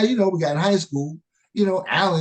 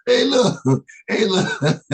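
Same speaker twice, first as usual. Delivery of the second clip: mock catchphrase; 6 semitones higher, 6 dB louder, slower than usual. Friend mimics S1's catchphrase.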